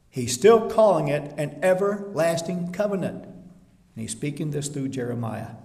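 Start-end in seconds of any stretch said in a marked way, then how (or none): none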